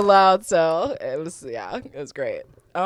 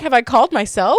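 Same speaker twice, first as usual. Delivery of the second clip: mock baffled caller; 7 semitones higher, 8 dB louder than usual. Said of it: "You're like".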